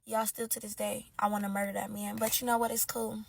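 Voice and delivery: low, soft confiding; quiet, deflated aside; subdued resignation. Acted. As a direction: drop down soft and let it go. Resigned admission after the outburst.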